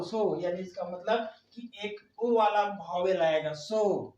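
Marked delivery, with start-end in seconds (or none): none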